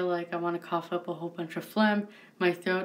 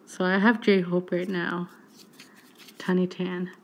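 ordinary talk

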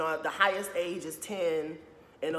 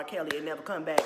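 ordinary talk, nothing marked

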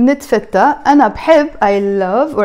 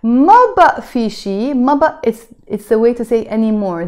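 usual speaking voice

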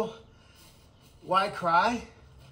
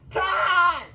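loud, much higher call across the yard; 9 semitones higher, 6 dB louder than usual. Real, not acted. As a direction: yell his name to get him over. Calling out to Todd.

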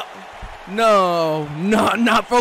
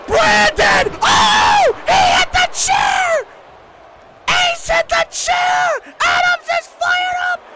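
frantic screamed commentary. Real, not acted. Commentator sees Ace hit the chair, screaming. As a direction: scream the action as it happens.

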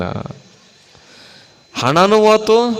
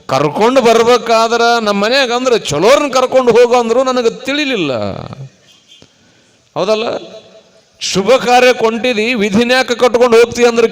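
higher-pitched, exasperated telling-off; storyteller's raised voice of reproach; acted scolding; 7 semitones higher, 5 dB louder than usual. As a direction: scold a companion you are fed up with. Acted scolding of her companion.

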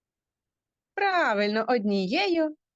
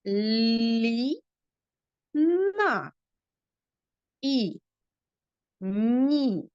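syllable by syllable; much slower than usual. Shown as a demonstration. Teacher sounds out a sentence for little pupils, syllable by syllable. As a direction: read Лі-на І Ні-на У Лі-сі slowly, one syllable at a time with pauses. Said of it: "промовляйте усі букви чітко і голосно".

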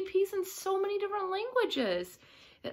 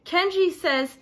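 plain reading voice

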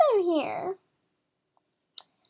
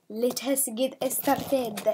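normal talking voice

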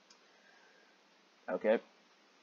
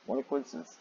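ordinary talk, nothing marked

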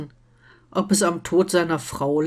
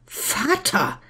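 higher-pitched, reproving call; exclaimed call; 4 semitones higher than usual. A narrator's reading. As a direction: cry out in mild alarm. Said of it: "rief sie aus".

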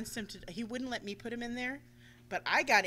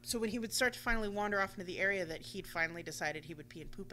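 ordinary delivery

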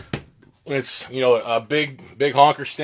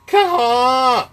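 high, loud goose "Caw"; spit moan; 10 semitones higher, 6 dB louder than usual. Shown as a demonstration. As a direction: honk it like a goose. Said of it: "So it's".

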